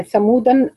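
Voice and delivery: ثمودا said "thamudan"; with tanween. Shown as a demonstration. Teacher showing the variant reading, ثمودا with tanween. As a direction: end ثمودا on a clear n. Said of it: "بالتنوين".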